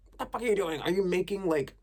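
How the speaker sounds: high, loud, exasperated yelling; mock-shouting at the neighbors upstairs. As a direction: yell up at the ceiling in disbelief.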